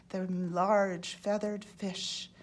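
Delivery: plain reading voice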